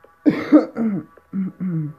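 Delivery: harsh loud coughing fit. Real, not acted. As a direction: cough hard into the mic.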